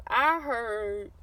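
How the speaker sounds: slow, halting delivery with a long pause; imitating teasing brother. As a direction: draw out the brother's teasing remark. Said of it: "did he say it like that".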